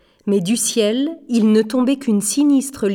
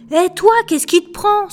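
high, louder challenge; startled, cross outburst pitched high; indignant protest; 9 semitones higher, 5 dB louder than usual. Acted. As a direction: snap back, affronted. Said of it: "se rebiffa-t-il".

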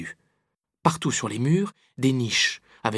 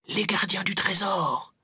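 high, drawn-out murmur of awe; odd clucking murmur; 8 semitones higher, slower than usual. A narrator's reading. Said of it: "murmura-t-il dans un drôle de gloussement".

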